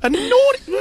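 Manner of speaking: very high, exaggerated disbelief; hammed-up astonishment; mock scandalised. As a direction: squeak it as if deeply shocked. Feigns shock at naughty story.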